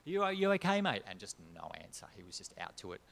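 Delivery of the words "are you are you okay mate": put-on loud worried call to a sleeping drunk. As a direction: shout to wake him, concerned. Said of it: "I went over to him and I said".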